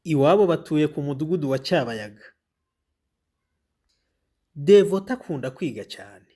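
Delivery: clear, even storybook reading; reading to children. Acted. Teacher reading the Devota story to pupils, clear and even.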